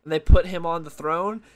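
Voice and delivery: ordinary talk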